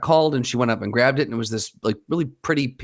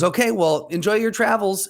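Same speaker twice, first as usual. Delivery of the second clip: higher-pitched imitation of a friendly goodbye; cheery young woman; 8 semitones higher than usual. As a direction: mimic a breezy farewell, pitched up. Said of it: "the young girl goes".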